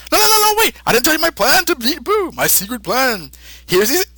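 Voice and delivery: very high, frantic calling out. Retold or imitated. Kaioshin stopping Goku from teleporting, very high and frantic. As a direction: yelp to stop him before he goes. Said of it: "the Kaioshin says".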